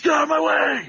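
high, loud wrestler yell; Ken Shamrock shout. Retold or imitated. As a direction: bellow it like a pro wrestler. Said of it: "I like Ken Shamrock's".

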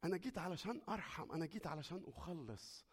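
fading from gentle voice to a whisper; tender. Acted. Preacher voicing God promising mercy, fading to a whisper.

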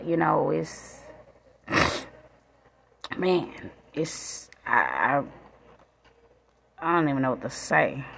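barely holding it together, sniffing between words; choked up. Real, not acted. Mother on her son turning thirteen, softly choked up. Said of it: "a little emotional, my baby".

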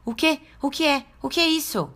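high, frantic startled yelps; startled panic. Acted. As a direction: jolt awake in fright. Wolf jolted awake, panicked.

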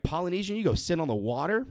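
ordinary talk, nothing marked

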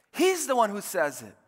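high, emphatic declaration falling at the end; emphatic proclamation. Real, not acted. Preacher declaring to church who says it, emphatic. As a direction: drive the point home from a high pitch. Preacher repeats climax, then brings it down.

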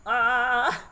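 loud, strained pushing cry; bearing down in labor; pushing groan. Retold or imitated. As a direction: let out a full-body push sound. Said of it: "full body intensity that's just like".

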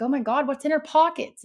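high, loud retold exclamation; alarmed question. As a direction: re-enact a startled, urgent question. Acting out her alarmed question.

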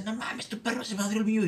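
high, heated complaint from an angry neighbour. Retold or imitated. Neighbour yelling about his dog, angry high voice. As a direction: mimic the furious neighbour's outburst.

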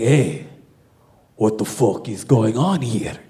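low, put-on Russian guard voice. Russian guard catches couple in back room, low imitated menace. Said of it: "So the Russian guy".

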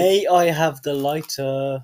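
"May I have the lighter?" said very slowly and deliberately; slow exaggerated politeness. Shown as a demonstration. Teaching his mate manners, slow and deliberate. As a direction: drag out every word of "May I have the lighter?" like a lesson. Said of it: "Then use manners".

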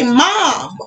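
ordinary delivery

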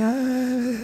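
a hint of a tired old man. Shown as a demonstration. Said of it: "Voz de velho".

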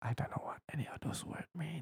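very soft, fast whisper; rushed hushed mumble; whispered aside. Real, not acted. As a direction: whisper it quickly under your breath. Muttered aside about unknown words.

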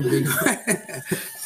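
hearty laugh breaking out; loud laugh. Real, not acted. A guest laughing at his own story, loud and hearty. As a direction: burst out laughing at the memory.

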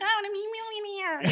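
high-pitched silly self-mockery; hammed-up childish wish, very high. Mimics own childhood wish, laughing.

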